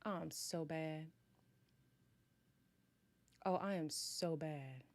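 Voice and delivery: normal talking voice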